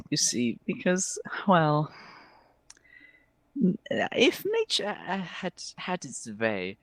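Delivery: high, hemming and hawing elf; theatrical elf, regretful. Acted. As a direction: break bad news with dramatic regret. Game master voicing the elf.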